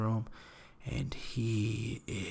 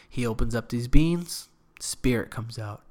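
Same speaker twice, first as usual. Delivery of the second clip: normal talking voice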